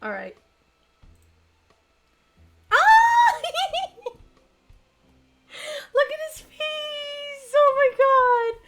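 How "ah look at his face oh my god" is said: high, loud gushing shriek; loud high squeal of delight; excited squeal. Real, not acted. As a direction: squeal over something adorable, drawing the words out. Squealing over a character's cute face.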